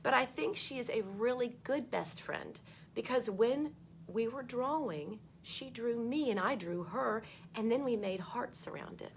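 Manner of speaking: put-on bright read-aloud voice for children; storybook reading. A narrator's reading. Teacher reading a book to kids, lively read-aloud.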